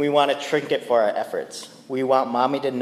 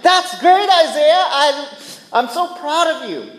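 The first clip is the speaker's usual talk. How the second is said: higher, louder praising dad voice; mock proud dad; 11 semitones higher, 6 dB louder than usual. Father acting the praise his son wants, higher and louder. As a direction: gush with a laugh. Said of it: "he wants me to be like".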